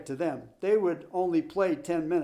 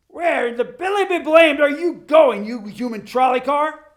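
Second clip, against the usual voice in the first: much higher, much louder furious sputter; sputtering rage; 9 semitones higher, 11 dB louder than usual. Acted. Coach chasing his runaway player, sputtering with fury. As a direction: blast him like an outraged coach. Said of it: "he sputtered".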